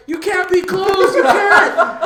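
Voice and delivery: pleading with the clerk through the locked door, high and loud; pleading shout. Retold or imitated. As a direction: beg through laughter, voice cracking high. Begging to get ice cream.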